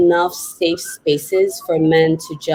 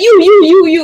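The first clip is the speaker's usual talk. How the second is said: loud, much higher, insistent pointing out; calling someone out; 11 semitones higher, 9 dB louder than usual. Retold or imitated. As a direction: call her out from across the room. Host singling her out in crowd.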